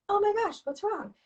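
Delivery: high-pitched startled concern, acted; alarmed parent. Mom to her screaming child, suddenly alarmed. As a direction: react as if just noticing the child is upset.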